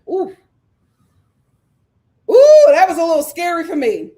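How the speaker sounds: high, loud mock-scared ooh; hammed-up startled ooh; mock alarm. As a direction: pretend to be spooked.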